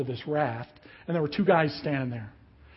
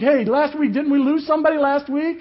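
high, loud teasing call-out; teasing mock alarm; 10 semitones higher, 9 dB louder than usual. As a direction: mess with a nervous friend, raising pitch and volume. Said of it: "he's messing with my friend".

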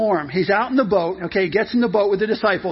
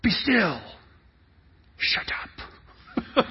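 hushed, restrained orders with long pauses; fed-up parent; 4 dB quieter, much slower than usual. Acted. Parents to bickering kids in the car, tightly hushed. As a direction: shush the children under the breath. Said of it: "the parents are like".

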